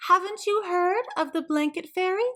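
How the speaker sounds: high, prim neighbour voice; a busybody's high voice; nosy neighbour voice. A narrator's reading.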